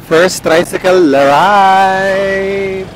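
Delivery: very slow, drawn-out announcement; grand announcement. Pretend. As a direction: savour each word like a big event. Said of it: "announcing first trike ride". (mabagal at engrandeng anunsyo)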